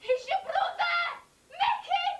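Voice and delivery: high frantic shout; desperate shout. Acted. Mother to Mickey to stop him shooting, screaming.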